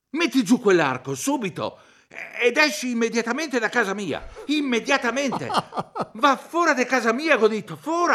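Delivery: a hint of a flustered homeowner; indignant orders. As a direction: throw him out, half scared. Ordering intruder out of house.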